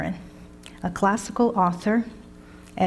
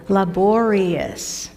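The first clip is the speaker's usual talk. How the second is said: drawn out; much slower than usual. Shown as a demonstration. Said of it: "teacher drawing out vocabulary word".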